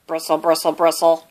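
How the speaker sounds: scrubbing brush noise; brushing sound. A narrator's reading. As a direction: mimic a toothbrush. Toothbrush noise imitated.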